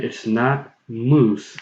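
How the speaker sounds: ordinary talk, nothing marked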